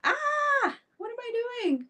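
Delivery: high flustered self-scolding; flustered surprise. Real, not acted. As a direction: squeak at your own slip. Catches her own mistake.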